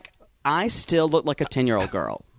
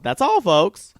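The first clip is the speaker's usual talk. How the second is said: cartoon sign-off voice; 9 semitones higher, 7 dB louder, slower than usual.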